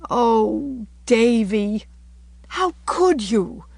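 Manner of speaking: high, slow, hurt reproach; plead, wounded and near tears; quivering voice. A narrator's reading. Anne, tearful, reproaches Davy, with a quiver. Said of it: "with a quiver in her voice".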